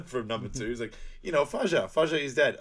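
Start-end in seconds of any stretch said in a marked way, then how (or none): 1.2-2.6 put-on higher, louder silly Dutch accent; comic Dutch accent, insisting; mock Dutch accent. Explaining about the father, mock Dutch accent. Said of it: "I have a Dutch accent".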